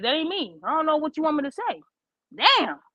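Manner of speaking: ordinary delivery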